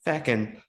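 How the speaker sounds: "Feckin'" with an e in place of u; Irish "feckin". Shown as a demonstration. Pointing out the Irish form, "Feckin'" with an e. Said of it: "actually Irish".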